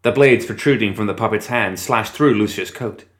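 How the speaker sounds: plain reading voice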